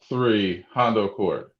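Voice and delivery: normal talking voice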